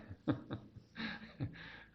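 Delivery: light, quiet chuckle. Real, not acted. Teacher joking to listeners about ageing and the mirror, soft chuckle. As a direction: chuckle softly at your own joke.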